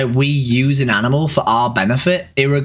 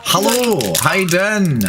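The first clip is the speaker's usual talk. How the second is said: higher-pitched Northern Irish lilt; Northern Irish accent; 5 semitones higher, slower than usual. Acted. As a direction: put on a Belfast accent. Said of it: "I can't do the Northern Irish accent".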